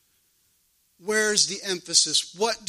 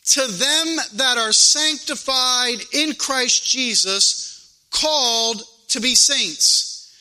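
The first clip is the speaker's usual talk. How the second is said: deliberate, emphatic scripture proclamation; measured preacher cadence with weight; preaching; 4 semitones higher, 4 dB louder than usual. Real, not acted. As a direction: read it out from the pulpit, stretching key words. Reads and expounds scripture.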